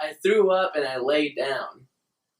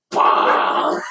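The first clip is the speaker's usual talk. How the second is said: deep, loud shouted "bah"; mock retch, bellowed low; roaring vomit noise; 7 dB louder than usual. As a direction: imitate a huge heave into the toilet. Imitates the second vomit burst.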